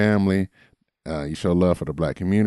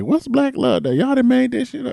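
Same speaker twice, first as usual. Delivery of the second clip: doubting listener. Retold or imitated.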